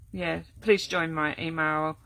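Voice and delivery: normal talking voice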